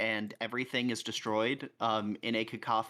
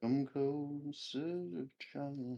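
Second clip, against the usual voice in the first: slow, hushed creepy crone; old woman whispering; 3 semitones higher, 6 dB quieter, much slower than usual. Acted. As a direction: beckon softly like a witch. Creepy aside during chat.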